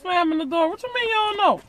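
scolding. Real, not acted. Scolding the kid.

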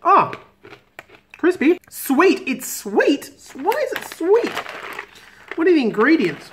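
talking between bites; chewing. Real, not acted. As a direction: mouth partly full. Seen 0: Bites anchovy snack at 13.9; chews while saying "crispy.

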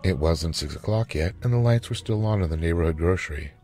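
ordinary delivery

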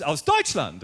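imitated pride, low-pitched; mock-proud declaration. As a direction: announce it like a proud old lady. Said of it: "sie sagte ganz stolz".

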